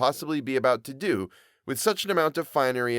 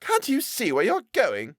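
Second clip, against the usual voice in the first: slow, higher scolding; irritated boy; 12 semitones higher, slower than usual. Acted. New arrival scolding the hosier's boy, irritated. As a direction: snappish street-boy retort. Boys quarrel.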